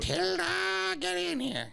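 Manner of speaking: high, drawn-out mock call. Co-host pretending to summon a dog with a long name, mock high call. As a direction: holler for a dog, stretched out high.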